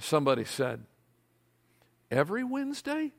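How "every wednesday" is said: high, soft incredulous question; a lazy worker shocked at working at all; mock dismay. A writer dismayed at working weekly, high and soft.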